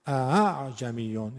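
اعجمیون very slowly, second hamza only breathed; eased second hamza. Shown as a demonstration. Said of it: "برای دومی هل به صدا میدیم فقط". (اعجمیون کشیده با همزهٔ دوم نرم)

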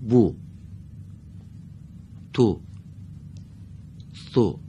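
«بو، تو، سو» each with a short Arabic u; short damma. Shown as a demonstration. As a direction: recite «بو، تو، سو» one at a time, vowels brief. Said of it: "اینها با ضمهٔ کوتاه خونده شد". («بو، تو، سو» با ضمهٔ کوتاه عربی)

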